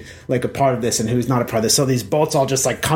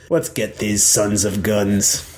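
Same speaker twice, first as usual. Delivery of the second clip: low, slow king's voice; resolute king; 5 semitones lower, slower than usual. Acted. King with giant sword to adventurer, low deliberate resolve. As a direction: drop the pitch and draw each word out.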